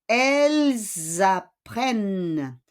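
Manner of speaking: slow "elles apprennent" with a long buzzing Z; teacher stressing the plural sound in "elles apprennent"; plural Z liaison. Shown as a demonstration. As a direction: draw out "elles apprennent" as elle-zapprenne. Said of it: "doon mo malalaman".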